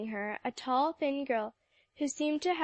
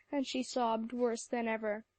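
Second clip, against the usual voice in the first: ordinary delivery, nothing marked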